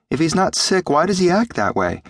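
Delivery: high, puzzled lad; high-pitched youthful questioning; worried boy. A narrator's reading. Boy Jim questions the doctor.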